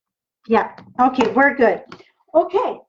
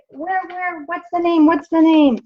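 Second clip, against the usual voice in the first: mock pleading questions, higher pitch; insistent questioners; 4 semitones higher, 3 dB louder than usual. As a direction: imitate viewers pestering for a product name. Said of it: "every time I use that, people say".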